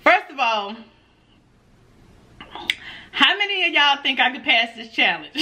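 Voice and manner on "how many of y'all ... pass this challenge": normal talking voice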